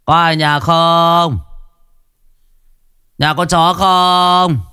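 slow taunting call. Acted. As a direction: call over the gate, taunting. Hào banging on gate.

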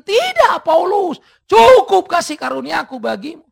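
loud, high, steady refusal; God answering Paul loudly; God's loud answer. Acted. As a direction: proclaim the no as God. God refusing third time.

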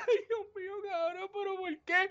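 high, hammed-up crying wail; mock bawling. Retelling crying over Gears of War, mock bawling. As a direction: wail it like a sobbing gamer. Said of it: "I was bawling".

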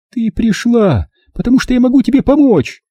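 high, sharp maternal retort. A narrator's reading. Mother following daughter onto landing, sharp retort. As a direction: fire back high and curt. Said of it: "резко бросила мама".

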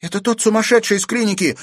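fast, high, loud alarm; girl's cry. A narrator's reading. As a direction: cry out a warning. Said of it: "крикнула Катерина". (встревоженный крик девочки)

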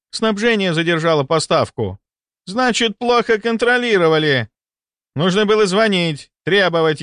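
high-pitched, indignant workplace dressing-down; angry berating. A narrator's reading. Boss berates an elderly nurse over late supplies, angry and high.

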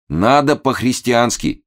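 high, earnest, slowed exhortation; agitated urging. A narrator's reading. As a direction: urge "по-христиански" as a heartfelt statement. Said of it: "очень волнуясь". (проникновенно и взволнованно убеждает)

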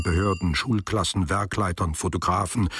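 usual speaking voice

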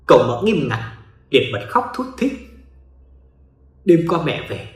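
usual speaking voice

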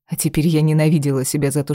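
plain reading voice

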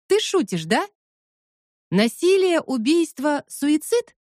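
high, disbelieving questions; incredulous. Acted. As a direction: repeat the words in disbelief. Incredulous repeat of slogans. (недоверчиво, высоким голосом)

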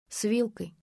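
ordinary delivery

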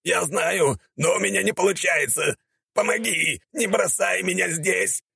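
high, desperate warrior plea; hammed-up helpless wail; panicked begging. A narrator's reading. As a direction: beg in a squeezed-up high voice. Warrior begs for help, panicking.

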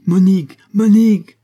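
high, very loud summons; shouted call. A narrator's reading. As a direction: shout the name through the house. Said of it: "crièrent dix voix". (appel crié à tue-tête)

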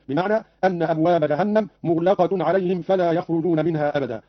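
ordinary talk

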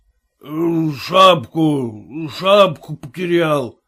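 put-on very slow, lowing drunken mumble. A narrator's reading. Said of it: "мычал Лукошкин".

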